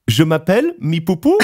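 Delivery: learner's French, lilting up into a question; lightly acted beginner French with questioning rise; imitating tentative French. Drunk stranger asks men to translate, imitated learner's French. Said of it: "like, a heavier French accent".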